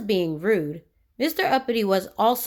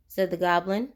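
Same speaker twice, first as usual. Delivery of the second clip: ordinary delivery, nothing marked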